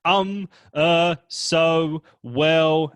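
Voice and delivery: loud, deliberate um and uh; filler sounds demo. Shown as a demonstration. Coach demoing filler words, hammed up and loud. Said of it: "nasty filler words".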